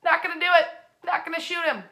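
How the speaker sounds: hammed-up cartoon president refusing; firm refusal. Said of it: "looking back at this little bear and saying".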